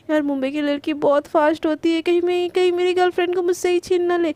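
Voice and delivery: usual speaking voice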